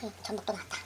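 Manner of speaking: hushed whisper. Real, not acted. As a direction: whisper it excitedly. Whisper over food.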